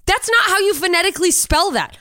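high, loud frustration; fed up and ranting; exasperated. Real, not acted. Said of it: "I fucking hate this".

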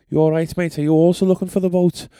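high-pitched mock Liverpudlian; Liverpudlian accent. Podcaster playing a stranger at the boat, high-pitched Liverpudlian accent. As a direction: put on a high Scouse voice for a friendly stranger. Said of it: "who were Liverpudlian".

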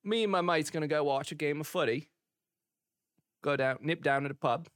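chatty mock English pub lad; English lad accent. Lad planning pub and footy, English accent. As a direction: reel off plans like an English lad. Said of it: "you also have this English accent".

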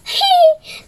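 high delighted giggle; high laugh. Acted. Pinocho laughing at the puppet show. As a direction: laugh out loud, high and happy. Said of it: "Le hacía reír a Pinocho".

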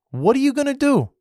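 YOU pushed up high and hard; heated rhetorical question. Real, not acted. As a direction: hammer the question home, voice climbing. Repeats challenge, much higher.

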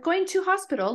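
lightly put-on British accent; mock British. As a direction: chirp it like a Brit saying hospital. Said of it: "She's like".

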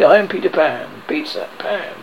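ordinary delivery

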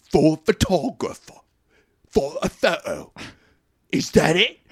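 hammed-up British film-star impression; Bale. British driver questioning the photo stunt, mock incredulous. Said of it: "It's pretty Bale".

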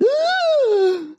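sharp high gasp; imitated shocked gasp. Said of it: "Lindsay Lohan goes".

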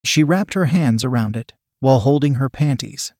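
usual speaking voice

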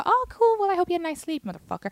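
high, sugary sarcastic sweetness; fake-sweet brush-off. Sarcastic reply to late boyfriend.

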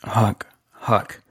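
hushed urgent whisper of the name. Acted. Hustler whispering to old buddy Huck, hushed.

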